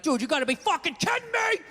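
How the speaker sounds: mock outraged yell at the screen; hammed-up yell at a bad call; yelling at the TV ref. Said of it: "you're screaming at the ref on T V".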